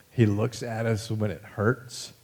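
ordinary delivery

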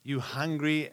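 normal talking voice

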